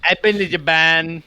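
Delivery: put-on trying out a Japanese accent; imitating a Japanese accent, tentative. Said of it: "can you do a Japanese accent".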